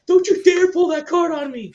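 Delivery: high, loud mock outrage; indignant yell. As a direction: shout the warning like a strict principal. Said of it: "And P C principal's like".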